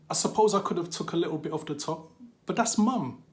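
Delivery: high, exasperated reasoning; agitated protest. Acted. Grieving child weighing skimming mum's ashes, high agitated protest. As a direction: build to a loud, protesting finish.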